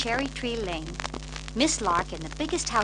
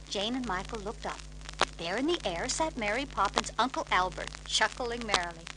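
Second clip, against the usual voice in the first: plain reading voice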